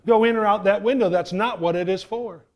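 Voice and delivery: acted stern scolding, hard on EVER; scolding grandma. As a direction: lay down the law to a child, slowing at the end. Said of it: "spanked his butt and told him".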